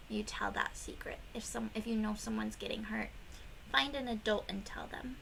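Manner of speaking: fast unvoiced whisper. Teacher whispering about telling a secret.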